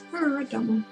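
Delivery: ordinary delivery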